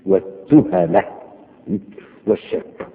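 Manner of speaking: ordinary talk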